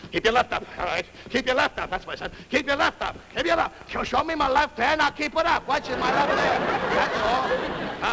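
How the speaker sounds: high, pressing drill commands; insistent boxing trainer. Acted. Trainer drills the fighter on his left, insistent.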